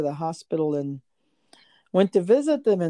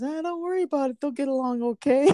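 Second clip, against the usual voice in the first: breezy husband; 9 semitones higher, faster than usual. Retold or imitated. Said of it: "He goes".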